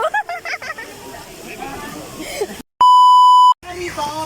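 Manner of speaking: loud high squealing laugh; ticklish shriek of laughter. Real, not acted. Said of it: "nakikiliti ako". (malakas na tiling tawa)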